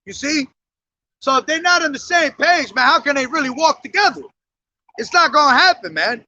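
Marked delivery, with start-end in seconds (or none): none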